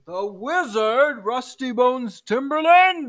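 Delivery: slow, rising astonished echo; giant turtle king. Acted. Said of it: "the giant turtle king".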